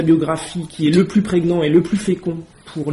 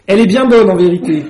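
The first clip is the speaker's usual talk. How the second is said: deep contempt; 2 semitones higher, 9 dB louder than usual. A narrator's reading. Pigeon scoffing at Alice's claim, loud deep contempt. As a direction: pour scorn on her claim. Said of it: "sur le ton du plus profond mépris".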